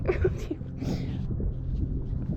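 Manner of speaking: high, voiceless wheezing laugh; long breathy giggling fit; helpless laughter. Real, not acted. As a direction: lose it laughing. Laughing at failed fishing lesson.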